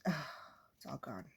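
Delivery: soft, low, slow, deflated; quiet letdown after the erasing; deflated sigh. Acted. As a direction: sigh as it all disappears. Poem fully erased.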